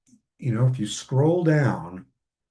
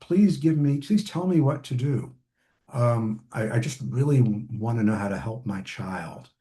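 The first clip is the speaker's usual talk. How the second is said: helpless parent; 3 semitones higher than usual. Retold or imitated. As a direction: beg for help like a parent at a loss. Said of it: "They'll, like, say".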